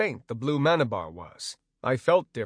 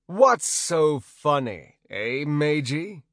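slow, loud menacing thug; tough guy; 3 semitones higher, 4 dB louder, slower than usual. Acted. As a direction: lean in like a bandit boss. Bandit leader challenging.

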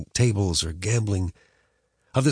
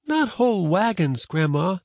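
put-on higher, doubtful boy; boy's voice; 10 semitones higher than usual. A narrator's reading. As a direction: correct her like a boy. Boy correcting.

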